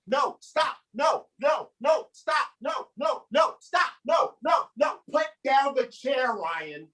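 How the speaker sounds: put-on high, repeated scolding cries of a kids' teacher; exasperated teacher scolding. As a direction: act out a worn-out teacher calling a child to order. Acted teacher scolding kids all day.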